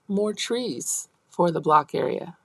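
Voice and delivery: normal talking voice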